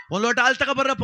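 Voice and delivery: high, loud call to the crowd. Acted. Dasappa calls loudly to the crowd for a glass of milk. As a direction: shout the order across the room.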